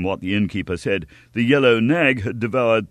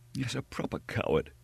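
softer, breathless; gasping; 4 semitones higher, 10 dB quieter than usual. A narrator's reading. Fallen young man to the innkeeper, weak gasp. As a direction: gasp it out, spent. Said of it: "gasped D'Artagnan".

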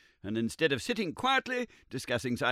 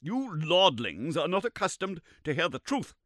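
higher, sneering with a smile; bitter smile; 10 semitones higher, 5 dB louder than usual. Acted. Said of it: "with a bitter smile".

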